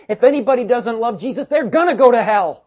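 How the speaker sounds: loud, high, forceful declaration; emphatic. Retold or imitated. Preacher voices Paul's own handwritten warning, loud and emphatic. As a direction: drive the warning home at full volume. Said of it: "because he's emphatic about this".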